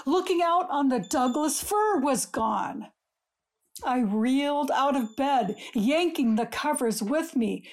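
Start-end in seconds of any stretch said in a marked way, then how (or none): none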